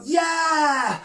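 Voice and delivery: high, long drawn-out cheer; demonstrated shout. Shown as a demonstration. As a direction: lead the children in a cheer.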